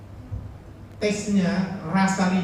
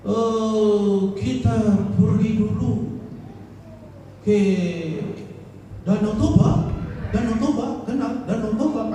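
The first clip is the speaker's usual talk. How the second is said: a lightly voiced Western accent on Indonesian, higher, slow on "pergi dulu"; foreigner's accent; 4 semitones higher, slower than usual. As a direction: mimic a foreigner's stiff Indonesian accent with a raised pitch. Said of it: "Dalam berbahasa aja logatnya pasti udah beda". (logat bule berbahasa Indonesia, ditirukan ringan dan lebih tinggi)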